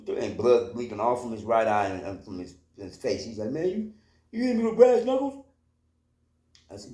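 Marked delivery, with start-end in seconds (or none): 3.5-5.4 much higher, louder, incredulous question; a hurt man's high, outraged disbelief; shocked disbelief. Retold or imitated. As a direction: imitate him stunned at what hit him. Bleeding guy shocked by knuckles.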